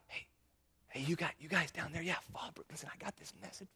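hushed, almost whispered call down from above; mock timid whisper. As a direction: whisper it like a timid messenger who dares not speak up.

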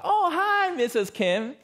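high, bright greeting at the door with a laugh; high-pitched friendly neighbor, laughing; cheerful neighbor. Retold or imitated. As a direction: greet her like a delighted American neighbor.

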